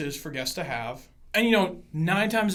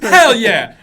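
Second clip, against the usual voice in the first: loud laughing exclamation; jokey emphatic shout through laughter; laughing boast; 3 semitones higher, 13 dB louder than usual. Real, not acted. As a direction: burst out with it, amused. Joking answer after laughing.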